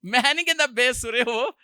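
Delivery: ordinary talk, nothing marked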